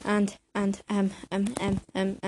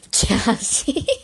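real giggle through the word; giggling; 4 semitones higher than usual. Real, not acted. Vlogger tickled by own word jazzy, giggling. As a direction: crack up while saying it.

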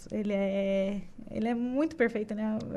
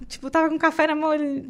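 normal talking voice

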